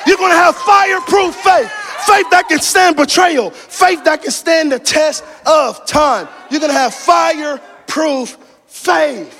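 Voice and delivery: high, loud shouted sermon; full-force preaching. Real, not acted. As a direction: preach it at the top of your voice.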